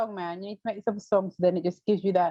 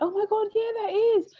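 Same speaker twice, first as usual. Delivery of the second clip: high-pitched retold astonishment; imitating own delighted surprise; amazed recognition. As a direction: squeal it as if it just clicked. Retells amazed recognition of an impression.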